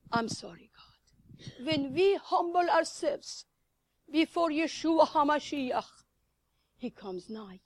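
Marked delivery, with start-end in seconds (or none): 0.1-1.6 hushed, contrite whisper; whispered prayer. Real, not acted. Preacher whispering a repentant prayer to God. 6.8-7.6 soft, hushed whisper. Real, not acted. Preacher whispering God's nearness to the congregation. Whispered promise during prayer.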